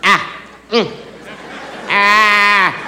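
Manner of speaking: acted groans of effort; straining grunts. Nephew struggles on the weight bench, straining. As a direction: grunt as if stuck under the bar.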